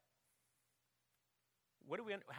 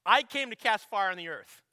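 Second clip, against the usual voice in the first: loud proclamation; 4 semitones higher, 16 dB louder than usual. Shown as a demonstration. Preacher to congregation, loud declaration. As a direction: ring out the verse boldly to the congregation. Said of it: "he simply says this".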